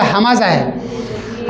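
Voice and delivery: हमाजा drawn out very slowly, each syllable clear; teacher spelling out हमाजा at a crawl; slow demonstration. Shown as a demonstration. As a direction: lean into the long a of हमाजा, very slowly. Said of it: "ये हमजा".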